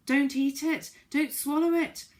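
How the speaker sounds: high, loud panicked yell; frantic shout at a friend eating cake. Acted. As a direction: stop her before she swallows. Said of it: "yelled Moonface".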